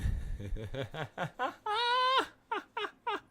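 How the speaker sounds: loud, high, helpless laughter; laughing fit. Real, not acted. Cody banging to get back in, real helpless laughter. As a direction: crack up until it almost sounds like crying.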